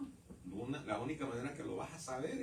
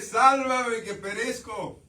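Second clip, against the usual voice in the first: loud, slow, high cry for rescue; desperate cry; 8 semitones higher, 12 dB louder, slower than usual. Retold or imitated. Preacher shouting Peter's cry as he sinks, slow and loud. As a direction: belt it like a drowning man's last plea.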